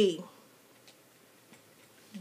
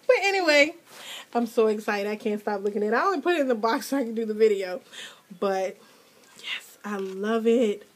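usual speaking voice

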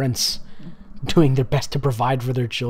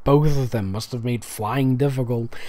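plain reading voice

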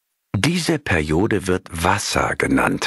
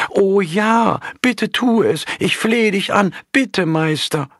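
put-on much higher, eager young monk begging; eager pleading; 12 semitones higher than usual. A narrator's reading. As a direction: plead breathlessly, oh please master. Junger Mönch fleht eifrig. (viel höher, eifrig flehend)

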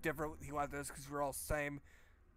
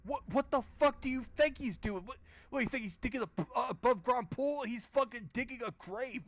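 high, loud exasperated snap; exasperated retort; 7 semitones higher, 3 dB louder than usual. Real, not acted. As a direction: fire back at the dumb question, fed up. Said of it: "What's that guy doing?".

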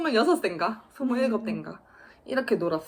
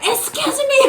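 웃으며 흉내 낸 영어; 14 semitones higher, 8 dB louder, much slower than usual. Pretend. 영어 억양을 흉내 내며 웃음.

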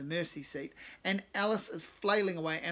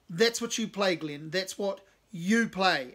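commanding; 3 semitones higher, 7 dB louder, slower than usual. Acted. Cave instructing Glenn loudly.

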